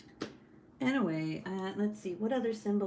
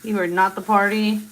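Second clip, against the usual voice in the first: much louder teasing put-down; mock scolding; 12 dB louder than usual. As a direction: call her out loudly, half joking. Teasing her sister.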